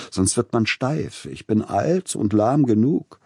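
calling out. A narrator's reading. Old composer joking to his friend, called out. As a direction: call it out with gusto, self-mocking. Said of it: "rief er aus".

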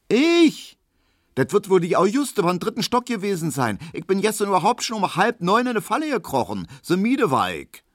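hoch und laut empört; entrüstetes Ich. A narrator's reading. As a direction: spiel gespielte Unschuld. Hedwig leugnet empört. (Hedwig leugnet gegenüber Kubinke, hoch und laut)

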